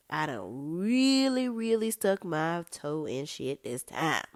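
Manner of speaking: slow, drawn-out regret; mock dismay. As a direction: stretch out "really" in exaggerated regret. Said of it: "she is going through it".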